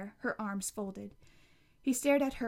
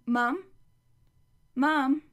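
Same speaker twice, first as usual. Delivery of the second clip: louder call rising up; 4 semitones higher, 9 dB louder than usual. Acted. Mother calling for her own mom, called out loud. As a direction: call out to her from another room.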